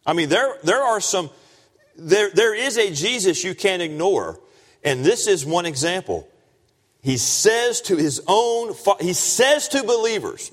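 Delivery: high, insistent preacher's voice; impassioned preaching. Real, not acted. Preaches at full force.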